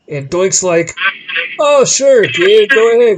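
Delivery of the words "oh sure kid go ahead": louder, higher, booming friendly yes; hearty wrestler voice. Retold or imitated. Doink the Clown booming a cheerful yes to the kid. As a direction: play the big, friendly wrestler agreeing loudly.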